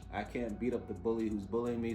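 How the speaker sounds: ordinary talk, nothing marked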